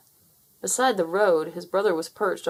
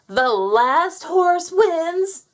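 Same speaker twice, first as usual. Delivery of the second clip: high, loud, slow outraged squawk; furious rooster squawk; 10 semitones higher, 7 dB louder, much slower than usual. Acted. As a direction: squawk it in disbelief. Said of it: "he squawked".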